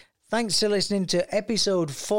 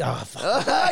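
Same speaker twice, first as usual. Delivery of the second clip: ordinary talk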